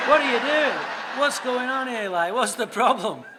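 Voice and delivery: loud, laughing busybody interruption; playful loud meddling; mock nosy outburst. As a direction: barge in loudly, half laughing. Butting in, acted loud.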